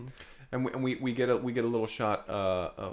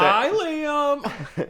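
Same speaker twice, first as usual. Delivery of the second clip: high greeting voice; 11 semitones higher, 5 dB louder than usual. Acted. Sandra greets Liam through window.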